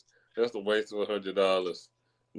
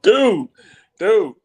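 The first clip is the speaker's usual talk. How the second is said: high, loud exclamation; 11 semitones higher, 9 dB louder than usual. Real, not acted. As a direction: call it out high and loud, twice. Exclaiming after co-host's joke.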